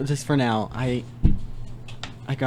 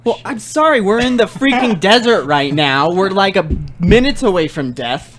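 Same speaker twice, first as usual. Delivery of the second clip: exasperated outburst, pitched up then settling; super mad; 2 semitones higher than usual. Acted. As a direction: blow up in mock fury over a complaint. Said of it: "gonna make Kevin super mad".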